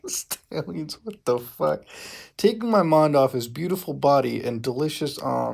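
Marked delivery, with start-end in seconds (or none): none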